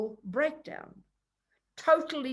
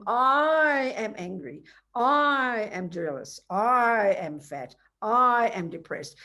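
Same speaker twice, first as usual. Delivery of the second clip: slow, louder, each "I" punched and falling; stress on I; 2 semitones higher, 5 dB louder, much slower than usual. Retold or imitated. Teacher mocking our obsession with I, heavy stress. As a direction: lean hard on "I" in every line. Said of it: "We just over-exaggerate the I".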